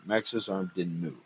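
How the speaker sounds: ordinary delivery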